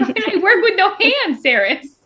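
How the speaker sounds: high-pitched mock-desperate plea, half laughing; hammed-up outrage. Elroy pleading with Saris over his hands, mock desperate. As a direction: wail it comically, laughter creeping in.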